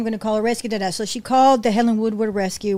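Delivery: ordinary talk, nothing marked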